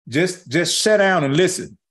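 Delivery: ordinary delivery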